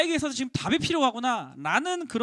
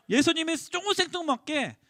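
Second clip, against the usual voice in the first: usual speaking voice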